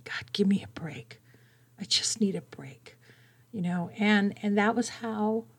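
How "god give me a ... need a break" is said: breathy whispered plea to God; whispered inner cry. Retold or imitated. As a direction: whisper it as the scream in your head. Inner scream, but whispered.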